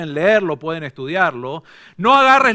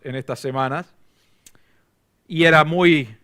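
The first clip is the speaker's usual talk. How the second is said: normal talking voice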